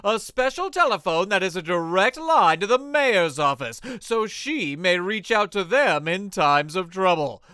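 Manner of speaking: dramatic radio serial narration over music; serial narrator. A narrator's reading. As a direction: set the scene like an old radio announcer.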